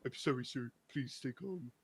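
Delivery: lightly mock a support bot telling him to stay calm; quoting customer support's scripted calm-down line, in a hushed, patronising mock voice; mock soothing support agent. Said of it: "'cause they're like".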